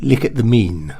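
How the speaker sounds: "mean" for moon, "lick" for look; exaggerated modern posh fronted vowels. Shown as a demonstration.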